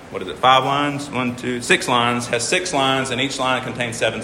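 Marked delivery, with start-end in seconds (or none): none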